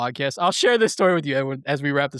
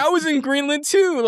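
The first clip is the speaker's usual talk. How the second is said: higher, louder, teasing reveal; mock boyfriend; 10 semitones higher, 4 dB louder than usual. Imagined boyfriend reveals he saw it too, playful mock. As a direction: play the smug stranger gloating.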